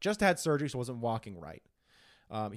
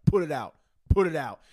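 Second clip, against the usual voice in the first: mock stern order; 7 dB louder than usual. Retold threat from student official.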